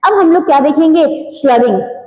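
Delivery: ordinary talk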